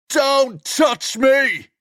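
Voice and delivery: very high, slow screaming; furious shout. Acted. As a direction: scream to keep him away. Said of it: "Charlie shouts".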